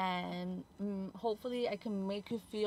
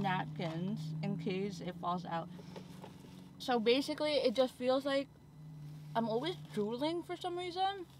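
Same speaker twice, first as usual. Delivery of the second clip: talking around drinking straw; words past straw in mouth; speaking with straw at lips; 4 semitones higher than usual. Real, not acted. Seen 1: talks with straw resting at lips, not sipping then.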